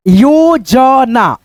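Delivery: very slow, drawn-out syllables; slow syllable reading. Shown as a demonstration. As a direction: spell it out slowly for the class. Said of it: "जैसे यहाँ पे देखो". (धीरे-धीरे अक्षर तोड़कर)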